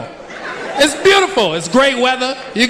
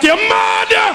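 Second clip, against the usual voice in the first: high, drawn-out curse; hammed-up indignant cry; mock Jamaican outrage; 8 semitones higher, much slower than usual. Jamaican passenger cursing the hijacker.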